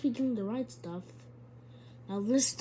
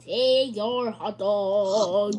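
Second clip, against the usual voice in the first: drawn out; 9 dB louder, much slower than usual. Pretend. Handing food to game character.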